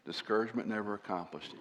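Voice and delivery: put-on soft, gentle repeat of an old pastor's advice; quiet encouragement. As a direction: lean in and murmur it kindly. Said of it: "He could tell when I was frustrated".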